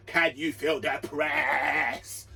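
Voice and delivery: slow dramatic hype line, rising loud on bruv; hyped-up rhetorical. Acted. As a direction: stretch every word to sell the press. Hyping up the team's press.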